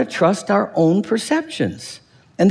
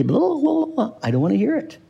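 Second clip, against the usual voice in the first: high, sing-song blah blah with fingers in ears; childish blah blah; 7 semitones higher, 3 dB quieter than usual. Retold or imitated. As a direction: play a child refusing to listen. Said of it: "stick our fingers in our ears".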